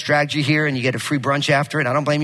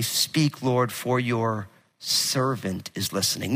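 quiet and a little slow, lower; softer, lower prayerful line; 6 semitones lower, 6 dB quieter, slower than usual. Acted. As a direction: say it gently, like a prayer. Repeats Eli's counsel.

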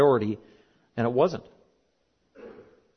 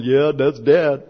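lightly mocked kids, slow and drawn out; kids' reaction; 5 dB louder, much slower than usual. Kids recognising Dad's rant, slow mock agreement. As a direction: imitate knowing children, stretching it out. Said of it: "then my kids are going".

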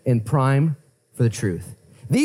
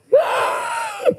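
shocked gasp. Acted. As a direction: gasp as if the tomb is suddenly empty. Women find Jesus' body gone.

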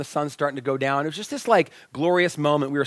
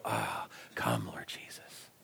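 much lower, softer sighing whisper; sigh under breath; 16 dB quieter, slower than usual. Retold or imitated. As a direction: let it fall out as a sigh. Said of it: "sigh quietly under his breath".

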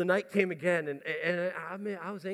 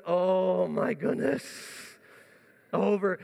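cold-water groan; 3 dB louder, much slower than usual. Retold or imitated. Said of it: "and going".

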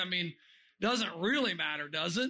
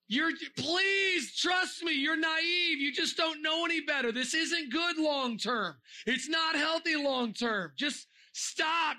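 pleading shout; 6 semitones higher, slower than usual. Retold or imitated. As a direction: beg the kids to stop, urgently. Pleading with vaping kids.

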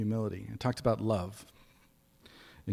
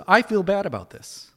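mock whiny apology; 9 semitones higher, 7 dB louder than usual. Mocking an empty apology.

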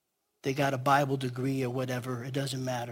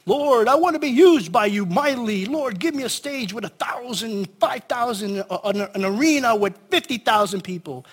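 high grandiose mock prayer; vain prayer voice; 6 semitones higher, 6 dB louder than usual. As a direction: pray it big and showy.

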